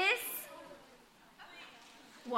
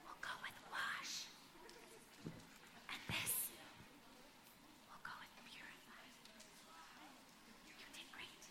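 whispering; 25 dB quieter than usual. Real, not acted. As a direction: whisper it privately. Whispering to a child up front.

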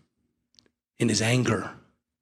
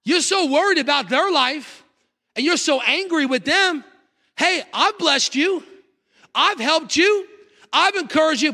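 God's pleading voice; 14 semitones higher than usual. Retold or imitated. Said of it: "God is like".

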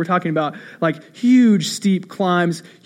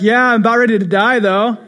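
shouted reply; 4 semitones higher, 6 dB louder than usual. Retold or imitated.

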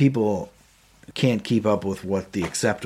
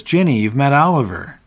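a higher, louder, forced-jolly introduction; overeager boss; 6 semitones higher, 6 dB louder than usual. Retold or imitated. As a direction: sell the meeting as if it's great fun. David's forced cheerful introduction.

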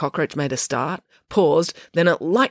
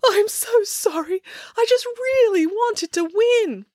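put-on very high, slow tearful girl's voice; slower than usual. A narrator's reading. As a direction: sob out an apology, high and slow. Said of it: "Matilda burst into tears".

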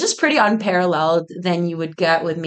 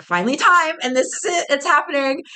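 high-pitched burst of anticipation; retold excitement; 8 semitones higher than usual. Founder retelling the moment their audition finally begins, excited. Voices the long-awaited moment arriving.

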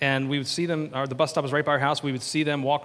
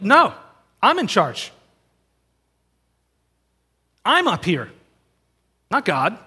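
loud, higher mock protest; defiant insistence; 4 semitones higher, 7 dB louder, slower than usual. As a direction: insist you're the one in charge. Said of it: "'Cause we're like".

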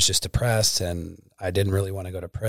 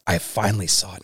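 ordinary delivery

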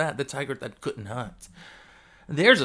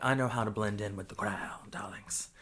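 unvoiced, hushed aside; whisper; 5 semitones lower, 7 dB quieter than usual. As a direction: whisper it like a secret.

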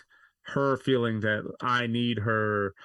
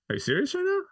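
very high mock disbelief; incredulous wife; faster than usual. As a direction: imitate the wife, stunned. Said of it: "And she goes".

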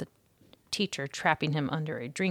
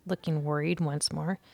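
usual speaking voice

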